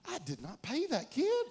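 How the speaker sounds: high, slow, aghast realization; shocked realization. Retold or imitated. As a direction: draw it out as it suddenly dawns on you. Acting out sudden horrified realization.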